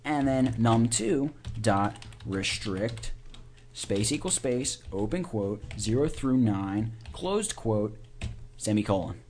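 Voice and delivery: slow dictation with punctuation. Shown as a demonstration. Dictating code syntax aloud.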